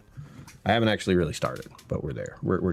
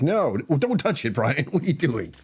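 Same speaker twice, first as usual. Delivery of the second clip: mock-alarmed self-scolding; 8 semitones higher than usual. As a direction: scold yourself in exaggerated alarm, pitched way up. Retold panicked scolding of himself.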